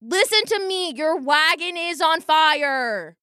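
high, pressing warning; boy warning the soothsayer of the fire; urgent warning. Acted. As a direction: cut through her act. Boy warning over her.

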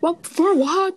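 high, slow, shouted complaint; imitating a racing driver's whine; Kimi Räikkönen. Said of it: "Kimi Räikkönen".

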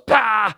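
loud, high burst of exasperation; exasperated "pah". Retold or imitated. Stumped man giving up before driving away, exasperated. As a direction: spit out one loud speechless puff. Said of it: "He just didn't know what to say".

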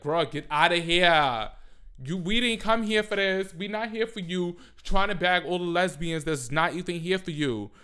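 loud, high, sassy telling-off; sassy scolding. Retold or imitated. Telling straight guys at Pride to get out, loud sassy scold. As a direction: shoo him away with loud attitude.

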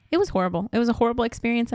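usual speaking voice

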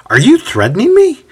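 high, incredulous challenge; indignant astonishment; surprised. A narrator's reading. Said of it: "Sosthenes asks in surprise".

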